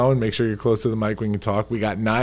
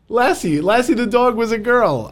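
high teasing voice; 13 semitones higher, 6 dB louder than usual. Pretend.

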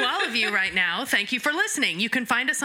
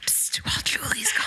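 secretive unvoiced whisper; leaning in to whisper. Conspiratorial aside to listeners.

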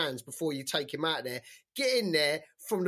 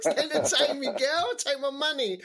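high, louder mock plea, laughing through it; mocking Kaya; 8 semitones higher, 5 dB louder than usual. Teasing Kaya about last night, high laughing mockery. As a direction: tease him by hamming up his line, giggling. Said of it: "That was Kaya last night to, uh, Sticky Vicky".

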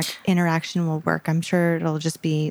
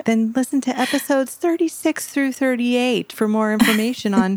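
big, raised radio announcer read; 6 semitones higher than usual. Acted. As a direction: announce it like a show host. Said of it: "That was my announcer voice".

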